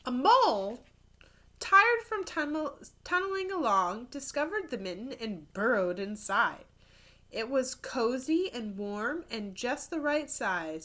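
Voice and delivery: put-on lively storybook narration for kids; animated read-aloud; reading to children. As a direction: tell it like a picture book at story time. Reads picture book aloud.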